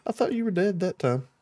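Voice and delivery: put-on high, giggly child ghost; creepy kid voice. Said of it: "like a kid laughing".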